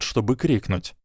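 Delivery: plain reading voice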